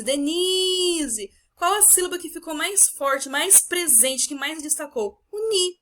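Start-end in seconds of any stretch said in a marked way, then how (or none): 0.0-1.3 deNIIIse, stretched as if calling her; drawn-out DENISE, leaning on NI, falling after. Shown as a demonstration. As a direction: call out "Denise" with NI held long. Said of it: "estou chamando a Denise".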